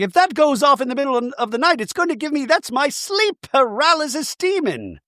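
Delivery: high, rapid protest; mock alarm. [name] reacting to a talking toy at night, alarmed. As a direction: imitate someone freaked out, high and hurried.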